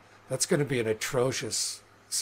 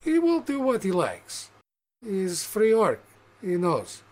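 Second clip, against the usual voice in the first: orc barkeep; 6 semitones higher than usual. Acted. Barkeep tells the visitor he will do as he likes. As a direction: lay down the law as the orc.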